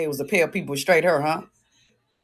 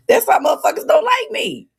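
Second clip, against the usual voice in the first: laughing shout; 6 dB louder than usual. Real, not acted.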